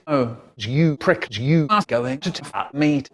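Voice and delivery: ordinary delivery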